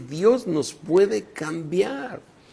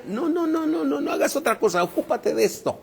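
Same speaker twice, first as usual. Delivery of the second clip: insistent correction; 9 semitones higher, faster than usual. Acted. Acts out a boss correcting worker.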